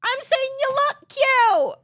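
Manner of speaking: high loud insistence; loud exasperated protest. Real, not acted.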